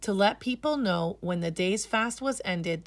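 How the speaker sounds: ordinary delivery, nothing marked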